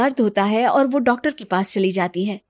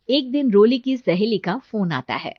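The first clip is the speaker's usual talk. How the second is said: ordinary delivery, nothing marked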